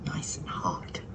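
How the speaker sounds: soft unvoiced whisper. Real, not acted. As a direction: whisper it to yourself. Whispered while taking hot cornbread.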